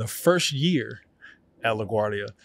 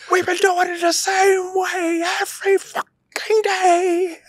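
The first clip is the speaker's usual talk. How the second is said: crying shout; 6 dB louder than usual. Retold or imitated. Teenage student sobbing and yelling at his acting teacher. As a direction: scream it while sobbing, at the end of your rope. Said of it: "Tears, snot".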